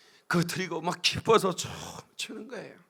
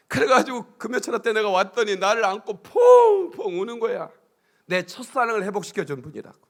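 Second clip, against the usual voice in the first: ordinary delivery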